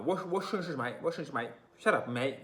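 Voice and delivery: a lighter roadman imitation; cheap English accent. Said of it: "cheap English accent".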